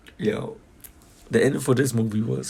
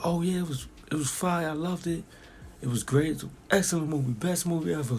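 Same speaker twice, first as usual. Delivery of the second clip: mock hyped fan voice, higher and quick; gushing fans; 8 semitones higher, 5 dB quieter than usual. Moviegoers gushing about the film, mock hyped. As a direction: imitate fans raving that it was fire. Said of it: "and they was like".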